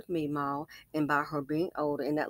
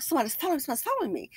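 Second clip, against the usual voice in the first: fake scared; 7 semitones higher, much faster than usual. Imitating old woman again.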